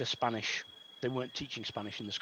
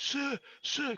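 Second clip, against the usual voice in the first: put-on much higher boyish voice for the kid; imitating Jack; 14 semitones higher than usual. Teacher imitating pupil Jack calling him, high boyish voice. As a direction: pitch it way up like an eager schoolboy. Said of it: "'cause he spoke a bit like this".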